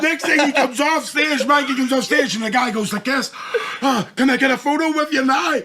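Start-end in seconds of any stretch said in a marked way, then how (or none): 3.8-5.6 high, loud eager plea; eager fan. Retold or imitated. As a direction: beg like a keen fan.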